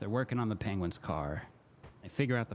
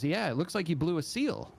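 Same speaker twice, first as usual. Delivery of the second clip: bright, higher-pitched delivery; upbeat mechanic voice; 8 semitones higher, 4 dB louder than usual. Acted. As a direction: sell the setup line cheerfully.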